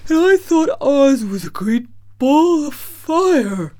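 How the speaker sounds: loud, high big-creature voice; exclaiming lion. A narrator's reading. Cowardly Lion tells what Oz seemed, exclaiming. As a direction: exclaim it loudly as a storybook lion. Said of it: "exclaimed the lion".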